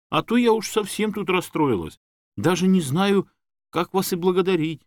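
high, emotional gushing; flustered, grateful woman. A narrator's reading. Woman unsure how to thank the man, gushing. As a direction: thank him as if overcome. Tamara overwhelmed with gratitude.

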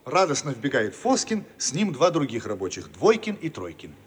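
ordinary delivery

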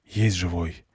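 clipped soft radio confirmation; quiet radio callout. A narrator's reading. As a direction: confirm under breath over radio.

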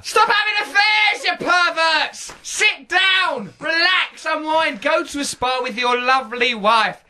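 hammed-up outraged shout; mock furious shout. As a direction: bellow it like an outraged preacher. Mock rant at cheating listeners.

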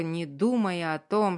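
plain reading voice

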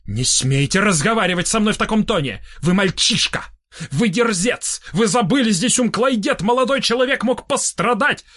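high-pitched, mounting outrage; indignant scolding. A narrator's reading. Толстый scolds Корнеев in a quarrel. (возмущённая отповедь, всё выше голосом)

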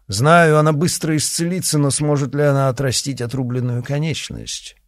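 high, loud sneering threat; cruel gloating. Acted. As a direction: relish every cruel word. Villain gloats over cruelty.

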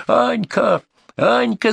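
very high, falling cries; frantic mother calling her child; wailing. A narrator's reading. Mother searches the dark for daughter, wailing her name. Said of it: "заголосила она".

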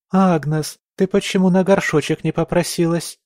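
talking through pinched nose; pinching nose. A narrator's reading. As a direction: hold your nose and scold. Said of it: "зажав нос, прогундосила Джессика". (гнусаво, зажав нос)

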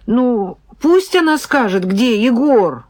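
loud, high-pitched urgent appeal; pleading. A narrator's reading. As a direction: beg insistently, pitch climbing. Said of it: "я попросила".

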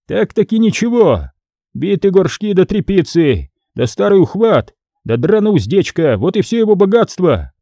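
lightly voiced scribe; scribe listing. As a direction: list the junk with contempt. Scribe lists poor goods.

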